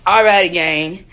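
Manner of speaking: loud upbeat vlog hello; vlog greeting. Real, not acted. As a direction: greet the viewers loudly. Vlog intro to camera.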